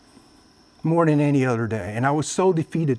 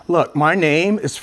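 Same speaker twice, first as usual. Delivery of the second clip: ordinary talk, nothing marked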